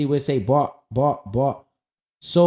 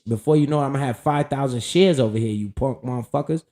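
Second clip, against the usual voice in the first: ordinary talk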